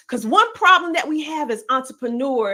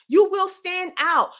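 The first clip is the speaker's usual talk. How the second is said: ordinary talk, nothing marked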